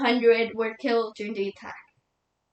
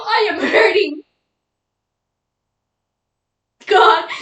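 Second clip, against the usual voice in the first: anguished outburst, higher and louder; hurt and indignant, building to a loud cry to God; grieving widow; 5 semitones higher, 4 dB louder than usual. Acted. Widow protesting that she must give her husband's eulogy, anguished.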